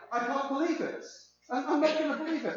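lightly voiced, high-pitched exasperated grumbler; half-hearted comic impression of indignant disbelief; Victor Meldrew. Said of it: "a bit kind of Victor Meldrew".

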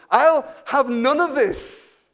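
loud, slow referee's telling-off; Nigel Owens. Retold or imitated. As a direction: scold big players like little kids, high and emphatic. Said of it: "telling them off like they were little kids".

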